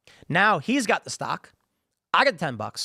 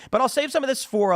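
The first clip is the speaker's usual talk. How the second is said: normal talking voice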